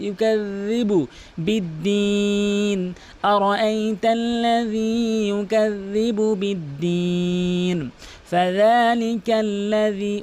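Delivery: slow, drawn-out tajweed chant; Quran recitation. Shown as a demonstration. Teacher recites the verses, slow measured chant.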